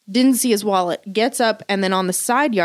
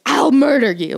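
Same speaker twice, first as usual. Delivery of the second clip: higher-pitched mock threat from an aggressive dog; menacing dog; 4 semitones higher, 4 dB louder than usual. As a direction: imitate a dog threatening murder, punched a little louder. Voices aggressive dog at another dog.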